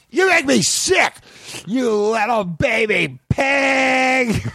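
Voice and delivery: very high, slow crying shout; angry caricature artist. Acted. Caricature artist sobbing and shouting insults at a customer. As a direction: wail the insult like he's breaking down.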